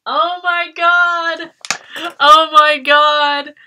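high, loud, drawn-out squeal; slow stretched excited scream; thrilled shriek. Real, not acted. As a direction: squeal with real delight. Overjoyed at friendship bracelet zine.

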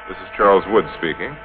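usual speaking voice

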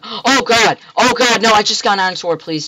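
high, loud panicked pleading; panicked scream. Real, not acted. Gamer under attack begs the mob, screaming in panic. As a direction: scream for mercy, terrified.

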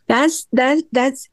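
ordinary talk

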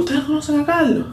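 aunt calling out from the car to people outside; calling out to strangers. Retold or imitated. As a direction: call across to people outside, asking.

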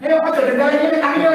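high, loud call to wake a dozer. Acted. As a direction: call out to rouse someone from sleep. Waking a dozing listener. (seruan keras membangunkan jemaat yang tertidur)

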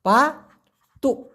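"পাতুক" stretched long and slow; slow, careful "পাতুক"; drawn-out demo. Shown as a demonstration. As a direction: drag out "পাতুক" to show how it's said. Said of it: "আর এটা হলো পাতুক".

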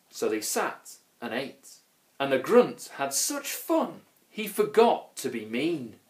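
ordinary delivery

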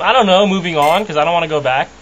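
normal talking voice

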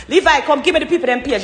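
loud call for the phone. Real, not acted. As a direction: shout across the house to Levi. Calling out to Levi.

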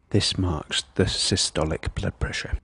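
ordinary delivery

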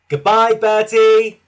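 loud, high, drawn-out farewell call; sing-out shout. A narrator's reading. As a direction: call a slow goodbye across distance. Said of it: "called Thomas".

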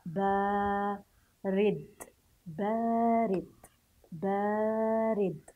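بارد very slow, with a long held aa; lengthened sound. Shown as a demonstration. Teacher modelling the stretched ba for pupils, slow drawn-out بارد. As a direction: stretch the first vowel of بارد right out. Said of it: "تمديد صوت الباء".

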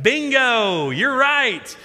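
loud, high game-show style cheer; big mock-celebratory shout; exuberant reveal. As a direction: draw it out high and triumphant. Father retells his triumphant reply.

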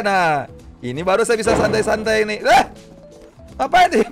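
normal talking voice

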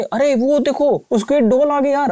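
high, slowed excited cry of alarm; spotting the enemy, voice jumps up. Real, not acted. Gamer spots Squid Doll units arriving, excited alarm.

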